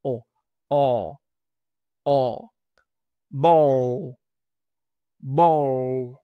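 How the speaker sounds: "O" with an open mouth; open o demo. Shown as a demonstration. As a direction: shape "A" but say "O". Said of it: "Abren la boca como decir A y dicen". ("O" abierta, boca como "A")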